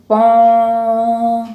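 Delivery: long, loud sung pon like a conch; sung conch blast. Shown as a demonstration. Teacher reading the written sounds aloud, loud sung conch hoot. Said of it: "शंख की ध्वनि".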